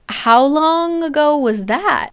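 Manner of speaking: lightly voiced suspicious Nesta; pressing him for the truth; suspicious question. Nesta suspicious confronting Cassian.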